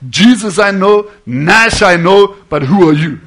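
loud, slow, deliberate declaration; emphatic challenge. Retold or imitated. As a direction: throw down each name forcefully, slowly. Voicing hell's challenge from scripture.

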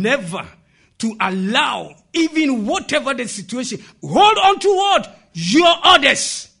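loud, high exhortation; preaching. Real, not acted. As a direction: drive the moral home to the congregation. Preaches the moral at full force.

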